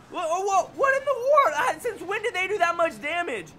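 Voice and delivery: real shocked squeal at the screen; stunned disbelief. Real, not acted. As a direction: squeal in total disbelief at the damage. Shocked by huge damage.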